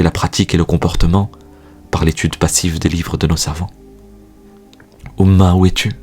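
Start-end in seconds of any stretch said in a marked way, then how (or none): none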